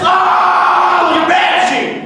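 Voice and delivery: high, loud shriek; screaming. Retold or imitated. Mother screaming at father in the street, high shriek. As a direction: shriek like a banshee. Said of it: "She's screaming like this".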